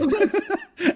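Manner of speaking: high squealing laugh; real burst of laughter. Real, not acted. Laughing at his own meme.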